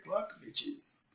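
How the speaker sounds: soft, whispered slogan; hushed mock motto. Quoting Pow's motto for life, hushed. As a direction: breathe it out softly like a motto.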